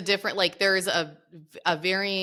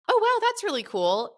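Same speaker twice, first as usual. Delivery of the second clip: high, bright-on-the-surface praise with nobody listening; fake enthusiasm; 12 semitones higher than usual. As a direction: gush over it without looking. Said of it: "And I have not been looking at it".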